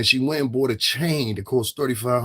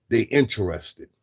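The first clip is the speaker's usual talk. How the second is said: normal talking voice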